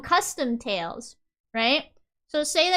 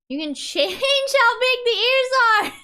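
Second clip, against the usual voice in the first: excited; 9 semitones higher, 4 dB louder than usual. Real, not acted. Excited about ear sliders.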